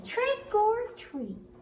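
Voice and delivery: very high, slow sing-song call; high drawn-out spooky call; high squeaky trick or treat. Acted. Bat says trick or treat.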